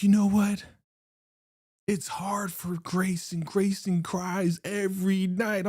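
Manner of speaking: put-on high-pitched, slow and dramatic girlfriend. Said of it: "She's like".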